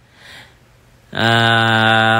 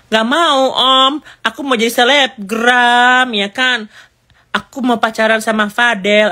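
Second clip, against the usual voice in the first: put-on much higher bratty teen girl voice, rebellious; Loli's voice; 10 semitones higher than usual. Teen Loli refuses Dokter Oki's offer, high bratty voice. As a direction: play a spoiled teenage girl refusing help, high and whiny. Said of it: "si Lolinya ngomong gini".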